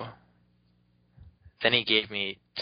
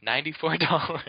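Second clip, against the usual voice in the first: higher, louder matter-of-fact clerk; shop lady quoting a price; 7 semitones higher, 4 dB louder than usual. Retold or imitated. The shop lady tells the kid the box price, a light high clerk voice. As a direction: state the price brightly like it's nothing. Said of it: "She was like".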